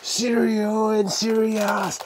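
high, very slow announcement; drawn-out hype. Pretend. Host presenting cereal to viewers, high drawn-out hype. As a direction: stretch each word out high. Hyping cereal being poured.

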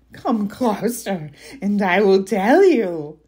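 slow, drawn-out coaxing creature; troll voice. Acted. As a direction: tempt them closer like a cunning troll. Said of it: "Your troll voice is on point".

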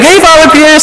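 imitating a delighted parishioner calling out; excited greeting. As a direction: greet the priest like a thrilled parishioner. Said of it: "some people are, like, excited".

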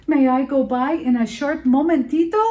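high, small pleading voice; tiny goat asking to pass; timid little goat. A narrator's reading. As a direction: ask it shaking and hopeful. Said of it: "hoof-shaking tiny cabrito".

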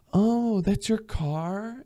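high, very slow mock admiration; impressed girl. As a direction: play the girl cooing over the car. Acted girl impressed by Lamborghini.

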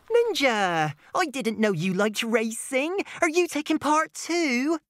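high, cheerful character voice; a friendly young unicorn asking; bright unicorn voice. Acted. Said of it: "Unicorn asked".

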